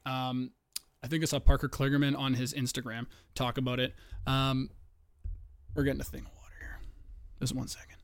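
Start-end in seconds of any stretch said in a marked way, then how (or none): 5.8-8.0 quick whispered aside; whispering. Real, not acted. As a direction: whisper it off-mic. Aside while water is fetched.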